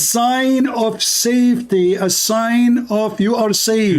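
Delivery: very slow, stretched-out words; emphatic declaration. Real, not acted. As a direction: spell it out slowly for an opponent. Hammering the point over interruptions.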